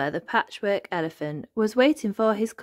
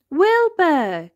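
high, loud cry of surprise; astonished exclamation; 10 semitones higher, 7 dB louder than usual. A narrator's reading. Elmer spots Wilbur up the tree, astonished. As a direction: exclaim the name in amazement. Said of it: "gasped Elmer".

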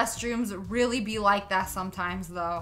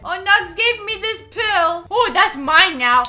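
higher, louder mock teacher voice; 7 semitones higher, 10 dB louder than usual. Imagining the teacher taking the pill, louder higher mock voice. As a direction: squeal it like the greedy teacher.

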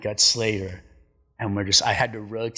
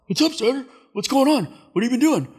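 high, loud imitation of an eager greeting; excited mimicry; 7 dB louder, faster than usual. Clint greeting Strider on the trip, excited high mimicry. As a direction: call it out way up high, all keyed up.

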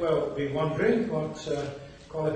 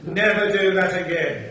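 stern scolding; 2 semitones higher, 7 dB louder than usual. Retold or imitated. Teacher catches him cheating.

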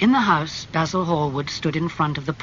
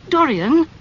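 Dorian, higher, louder, rising; unhappy plea; 13 semitones higher, 9 dB louder than usual. A narrator's reading. Basil calling his friend again, higher and rising. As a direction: Dorian cried out with a rising appeal. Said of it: "said Basil unhappily".

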